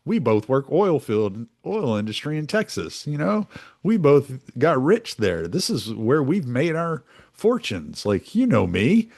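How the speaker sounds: high-pitched backhanded buddy-buddy politician; mock palling around with a rival; fake-friendly Bush. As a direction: say it through a smile, chummy and pitched well up. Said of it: "smile at him like".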